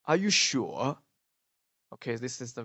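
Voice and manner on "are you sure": "Are you sure?" with a dip then lift on "sure"; doubtful question, "sure" swinging down and up; British questioning tune. Shown as a demonstration. Teacher quotes a British waiter, doubtful dipping question. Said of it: "a British way of saying this".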